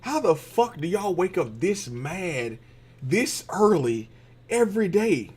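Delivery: slow, drawn-out incredulous question; baffled disbelief. Retold or imitated. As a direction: sound amazed at the neighbors. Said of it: "Like, I just like".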